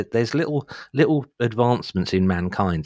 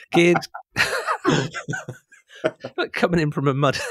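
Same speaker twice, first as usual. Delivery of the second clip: laughing; 10 semitones higher than usual. Real, not acted. Cracking up at own joke.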